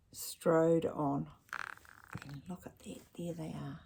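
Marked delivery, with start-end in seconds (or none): 2.2-3.8 much softer murmur; hushed aside. Acted. Reader murmurs to the child about Dad and Ned in the rain. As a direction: lean in and point quietly.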